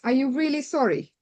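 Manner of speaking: ordinary delivery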